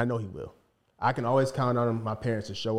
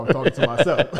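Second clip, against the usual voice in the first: laughing; 3 semitones higher, 9 dB louder than usual. Real, not acted. Laughs about dad not listening.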